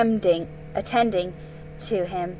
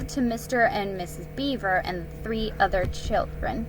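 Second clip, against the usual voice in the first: usual speaking voice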